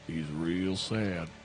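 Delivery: low, slow deadpan barkeep; gruff tavern guy. Acted. Tavern guy pointing to the kitchen, low slow deadpan. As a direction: drop it low and slow like a bored barman. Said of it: "tavern guy just points ... and just says".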